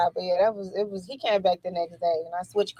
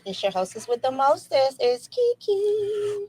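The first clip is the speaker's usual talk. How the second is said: high, stretched-out hostess intro; singsong show intro; 7 semitones higher, slower than usual. Pretend. Co-host introducing herself as hostess with the mostest, singsong. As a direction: draw your name out high and long.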